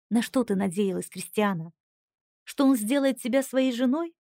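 plain reading voice

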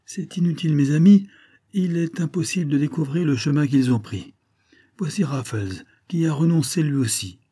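high, mildly winded report; voix essoufflée. A narrator's reading. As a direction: call out the bad news after a run. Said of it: "dit une voix essoufflée". (voix haute, un peu essoufflée)

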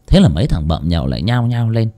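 ordinary delivery, nothing marked

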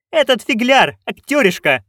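high, loud contempt; scornful duchess. Acted. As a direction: sneer down at the actor. Duchess scorns Tom John.

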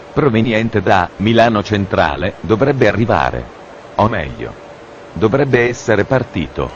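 ordinary delivery

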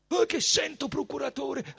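high, composed voice of a high priest; dignified, calm priest. A narrator's reading. Caifa answers the procurator, dignified and high-pitched. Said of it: "rispose dignitoso e calmo Caifa".

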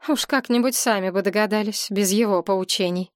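ordinary delivery, nothing marked

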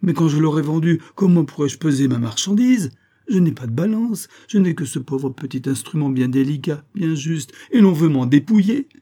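high, loud, aggrieved protest; indignant outcry. A narrator's reading. Usurer fearing to be stripped, indignant outcry. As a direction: protest as if being robbed. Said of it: "s'écria Isaac Akabut".

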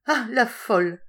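high, loud, jovial exclamation; amused cry. A narrator's reading. Warder catching the madwoman staring at him, amused cry. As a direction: call it out in amused mockery. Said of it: "cria-t-il en riant".

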